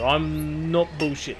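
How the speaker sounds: normal talking voice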